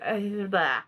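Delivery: dismissive blah blah blah; bored blah. Shown as a demonstration. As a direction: drone out your boredom. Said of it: "That's how I feel about it".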